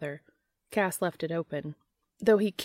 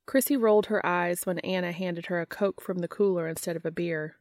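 usual speaking voice

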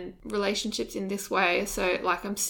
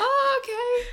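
high, much higher mock reply; high dismissive oh okay; 5 dB louder than usual. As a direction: squeak it out like a shrugging stranger. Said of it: "So they're just like".

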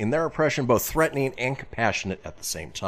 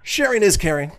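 over the top slogan; 10 semitones higher, 6 dB louder than usual. Pretend. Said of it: "a little over the top".

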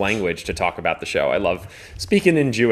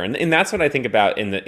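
ordinary delivery

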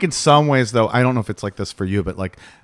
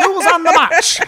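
put-on high old-timey newsreel voice; newsreel announcer; 4 dB louder than usual. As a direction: boom it like a newsreel. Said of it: "Do you hang out with nineteen twenties, like, news".

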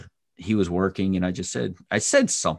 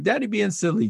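put-on child voice; 12 semitones higher than usual. Dad imitates son.

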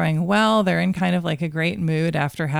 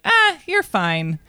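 dismissive adult; 7 semitones higher, 3 dB louder, slower than usual. Retold or imitated. Adult dismissing child, voiced.

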